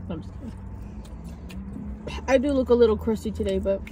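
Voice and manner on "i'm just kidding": whispered. Real, not acted. Whispered take-back after joke.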